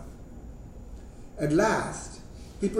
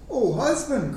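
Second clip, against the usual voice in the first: higher-pitched narrated appeal; a wife's mild cry to her husband; 6 semitones higher than usual. A narrator's reading. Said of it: "cried the woman".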